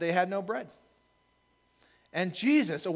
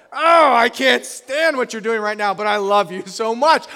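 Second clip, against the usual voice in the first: louder, higher, loving groan of frustration; affectionate exasperation; 6 semitones higher, 12 dB louder than usual. Retold or imitated. Dad to his rowdy kids at dinner, affectionate exasperation. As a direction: scold them while hugging them with your voice. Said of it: "this kind of affectionate head slap".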